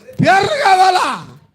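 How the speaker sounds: "పేర్లు కావాలా?" shouted high and slow; preacher's slow mocking shout of "పేర్లు కావాలా?"; shouted question. Shown as a demonstration. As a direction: fling "పేర్లు" out loud and stretched. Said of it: "అయ్యో, దాంతో జంతువులన్నిటికీ ఏం కావాలా?".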